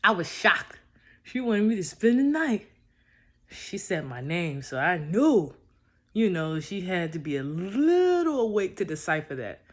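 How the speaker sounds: can't contain the excitement; excited. Real, not acted. Excited retelling of her invitation.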